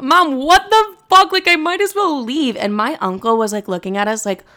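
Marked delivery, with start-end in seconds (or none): 0.0-2.6 much higher and louder, slow indignant shout; mock outraged yell. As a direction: shriek it at mom in stoned outrage. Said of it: "I was like".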